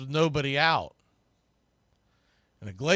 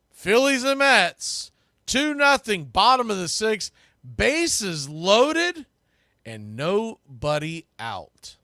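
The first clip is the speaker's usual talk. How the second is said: dramatic recap; 6 semitones higher, 3 dB louder, slower than usual. Real, not acted.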